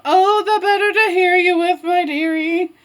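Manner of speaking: high, singsong fake granny; wolf posing as grandma. Wolf answering Red about big ears, sly granny voice. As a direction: raise the pitch and coo it like Grandma.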